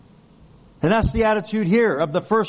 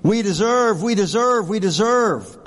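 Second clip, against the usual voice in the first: mock entitled insistence; 6 semitones higher than usual. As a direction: hammer deserve like a spoiled claim. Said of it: "sometimes we think".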